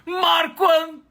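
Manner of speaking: very high loud shout of his name; elated shout. Acted. Manager greets him.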